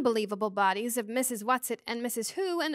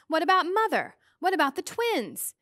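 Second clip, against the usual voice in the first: higher, urgent worried questions; anxious; 6 semitones higher, 4 dB louder than usual. A narrator's reading. Meg worries about Mother and twins, anxious. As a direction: press them with mild anxiety, pitched up. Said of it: "Meg asked anxiously".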